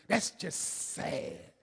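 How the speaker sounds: long, drawn-out disapproving "sad"; scornful scolding. Retold or imitated. Big Mama judging your sorry excuse, slow scolding. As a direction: imitate Big Mama's slow disgusted verdict. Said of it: "What she meant to say was".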